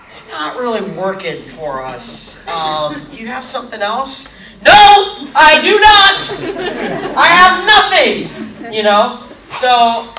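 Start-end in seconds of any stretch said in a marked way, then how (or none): 0.1-4.4 director. Retold or imitated. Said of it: "the director to come up and go". 4.6-8.7 high, loud, drawn-out mock desperation; panicked outburst. Actor answers director asking for something else, panicked outburst.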